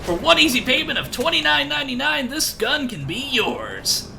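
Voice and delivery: high, loud infomercial announcer; hammy 2000s commercial pitch, slowing at the end; cheesy radio ad voice. Pretend. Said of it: "cheesy, like, two thousands radio commercial voices".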